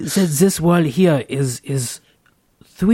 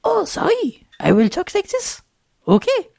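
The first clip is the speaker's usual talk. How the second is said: put-on high, squeaky kid voice; little kid voice. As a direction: talk like a small child. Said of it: "you have to use your little kid voice".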